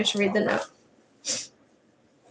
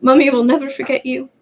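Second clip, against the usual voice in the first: higher, tearful giant; 4 semitones higher, 4 dB louder than usual. Acted. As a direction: promise it through tears. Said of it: "Hagrid sobbed".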